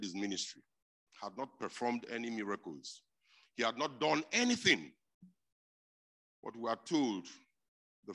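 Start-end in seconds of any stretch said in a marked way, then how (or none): 3.5-4.9 high, loud emphatic line; loud preaching. Real, not acted. Preacher pressing his point on the congregation, loud. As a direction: preach it with force. Preacher stressing at full force.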